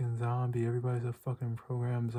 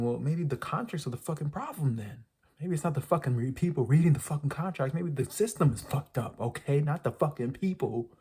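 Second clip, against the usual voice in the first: heated retort, pitched up and punching the swear words; exasperated rant; 4 semitones higher, 4 dB louder than usual. Real, not acted. Vlogger firing back at excuses for endless contracts, exasperated. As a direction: argue back hard, fed up.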